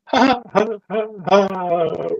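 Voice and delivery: high, forced nervous laugh. Acted. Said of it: "have a really nervous laugh".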